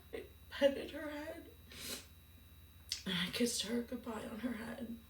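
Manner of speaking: fighting back real tears while telling it; choked up, barely getting the words out; real tearful crying. Real, not acted. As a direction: voice cracking into tears, then a hushed near-whisper. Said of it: "This story is not easy for me to tell".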